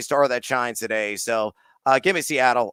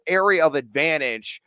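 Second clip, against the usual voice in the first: ordinary talk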